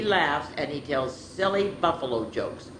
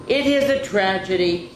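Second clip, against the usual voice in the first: dramatic, loud, higher lament; 5 semitones higher, 5 dB louder than usual. A narrator's reading. Zachary bemoaning the gloomy buffalo, lamenting. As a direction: declare it like a tragedy.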